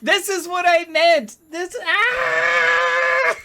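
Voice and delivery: high, loud cry of exasperation; exasperated yell. Real, not acted. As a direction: yell it, breaking into a scream. Exasperated at the game going wrong.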